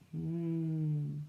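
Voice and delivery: plain reading voice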